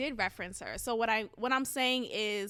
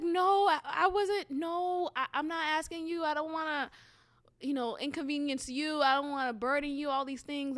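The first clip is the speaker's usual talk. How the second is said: higher pitched flustered refusal; refuse, embarrassed and flustered; protesting; 4 semitones higher than usual. Retold or imitated. Turning down her cousin's offer, higher and flustered. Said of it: "I was like".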